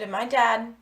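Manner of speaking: ordinary talk, nothing marked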